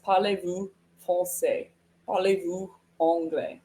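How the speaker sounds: textbook French question, "Parlez-vous" first; learner's schoolbook French; taught French phrase. Shown as a demonstration. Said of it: "That's what we're taught".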